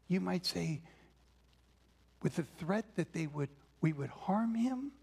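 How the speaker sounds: usual speaking voice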